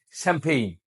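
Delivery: "শ্যাম্পেইন" as sempein, English style. Shown as a demonstration. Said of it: "ইংরেজরা কি বলে জানেন".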